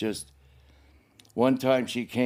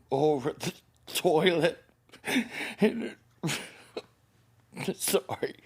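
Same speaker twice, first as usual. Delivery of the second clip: breaking down, voice going high and breathless; real crying; 5 semitones higher, slower than usual. Real, not acted. Man recalling childhood hunger, breaking down crying. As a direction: choke up and apologise through tears.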